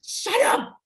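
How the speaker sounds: snapping at a panicking friend to stop; shouted shut up. Acted. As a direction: cut her off sharply.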